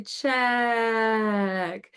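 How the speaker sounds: stretched, emphatic check; teacher leading the check gesture; drawn-out check. Shown as a demonstration. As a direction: call out check with the arm motion. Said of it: "So we say".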